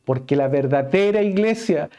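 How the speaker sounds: high, loud, impassioned preaching; preaching at full force. Acted. Preacher driving home the mark of a true church, full force. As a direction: proclaim it to the whole congregation.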